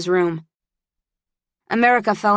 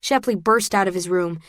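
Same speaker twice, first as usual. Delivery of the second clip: plain reading voice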